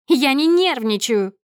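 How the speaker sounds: high, loud, slow indignant yell; shouted denial. A narrator's reading. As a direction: protest at the top of your temper. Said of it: "кричит она ещё громче". (возмущённый протяжный крик)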